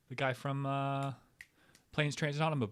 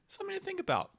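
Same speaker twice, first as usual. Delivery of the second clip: ordinary talk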